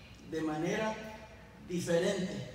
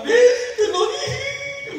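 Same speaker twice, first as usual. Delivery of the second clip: much higher, louder mock wail, slow; a hammed-up crying wail through laughter; wailing wife; 14 dB louder, much slower than usual. Wife wails at her husband, hammed-up and much higher. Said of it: "Empezó a llorar más".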